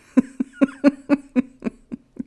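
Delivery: a loud burst of laughter; laughing at herself. Real, not acted. Laughing at her own codependency. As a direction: laugh it off at yourself.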